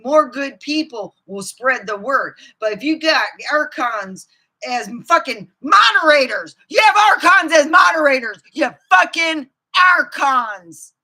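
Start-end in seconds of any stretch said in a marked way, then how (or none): 2.6-10.9 louder and higher, yelling at the Archons; angry shouting. Real, not acted. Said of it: "I am so pissed".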